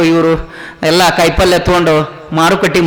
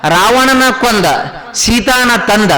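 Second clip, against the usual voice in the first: higher, measured rhyming chant; folk recitation; 5 semitones higher, slower than usual. Shown as a demonstration. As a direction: deliver three short rhymed beats, pausing after each. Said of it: "ನಮ್ಮ ಜಾನಪದೀಯರು ಹೆಂಗ್ ಹೇಳ್ತಿದ್ರು ರಾಮಾಯಣ". (ಜಾನಪದ ಪ್ರಾಸದ ಪಠಣ)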